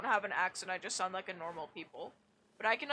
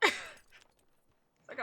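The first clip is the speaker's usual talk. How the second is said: breathy giggling through words; laugh-spoken; 10 semitones higher than usual. Real, not acted. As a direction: crack up at herself. Laughing at own math.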